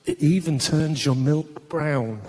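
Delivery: low, slow advert voice; advert voiceover. Retold or imitated. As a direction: sell it like a cereal ad. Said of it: "say the following sentence as if it was a positive".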